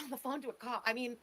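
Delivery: normal talking voice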